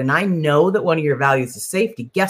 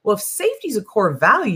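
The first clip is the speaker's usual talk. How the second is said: ordinary talk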